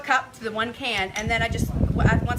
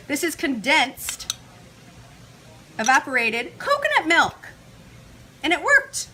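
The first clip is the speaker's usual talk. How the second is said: excited; 5 semitones higher, 6 dB louder, slower than usual. Real, not acted. Proudly shows vegan condensed milk.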